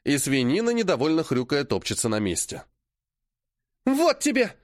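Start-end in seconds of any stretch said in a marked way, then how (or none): 3.9-4.5 shrill squeal. A narrator's reading. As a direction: gloat as you strike. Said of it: "звонкий визг".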